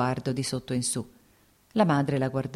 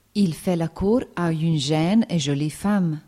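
slow, louder French quote; French phrase; 2 semitones higher, 5 dB louder, slower than usual. A narrator's reading. Dolly quoting society in French, slow. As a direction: say it with French flair. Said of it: "French phrase".

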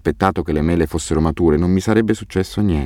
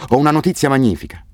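higher-pitched, eager news-breaking; excited, raised pitch; excited announcement; 8 semitones higher than usual. A narrator's reading. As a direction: announce wonderful news to someone next door. Calling good news through the house.

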